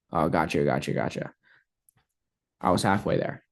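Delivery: the client answering quietly; prospect's reply. Acted.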